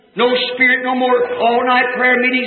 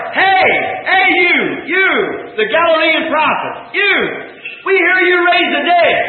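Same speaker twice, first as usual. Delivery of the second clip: mob hollering; 5 semitones higher than usual. Retold or imitated. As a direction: yell it out like a rough voice from the crowd. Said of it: "he heard somebody holler".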